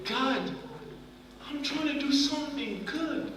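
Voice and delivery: high, hushed plea to God; anguished prayer. Retold or imitated. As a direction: cry out softly to God, hurt and confused. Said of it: "I was like".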